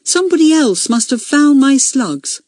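high, insistent, ringing protest; loud and ringing. A narrator's reading. Accused girl defending herself to meeting, ringing voice. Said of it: "in a loud, ringing voice".

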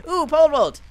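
high, loud exclamation; excited delight. Real, not acted. Player spotting the pole vault, excited cry.

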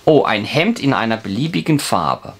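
ordinary talk, nothing marked

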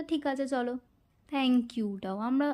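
usual speaking voice

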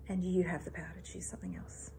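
whispered repetition, letting it sink in. Real, not acted. Coach leaving listeners with her message, whispered. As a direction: breathe the line out as a soft whisper, no voice.

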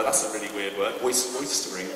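ordinary delivery

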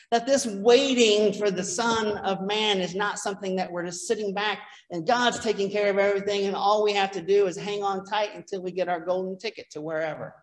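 ordinary talk, nothing marked

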